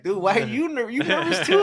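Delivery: high-pitched incredulous retold question; mock disbelief. Groom questioning his nervous groomsman, in mock disbelief.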